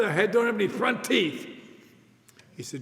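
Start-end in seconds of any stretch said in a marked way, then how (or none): 0.0-1.5 louder, higher, outraged mimic; outraged repeat of the jibe; indignant quote. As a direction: throw the insult back, offended. Quoting the insult indignantly.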